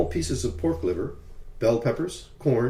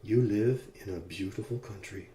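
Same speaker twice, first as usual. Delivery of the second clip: hushed, softer voice; soft near-whisper; 2 semitones lower, 6 dB quieter than usual. A narrator's reading. George to the little girl beside him, softly whispered. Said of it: "He settled for whispering".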